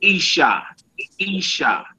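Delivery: Isha, said clearly as EE-shah, stress on the last syllable; Hebrew word said slowly. Shown as a demonstration. Teacher modeling Isha for the class, clear and careful. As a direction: model the Isha with an open ah ending. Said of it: "Woman or wife is".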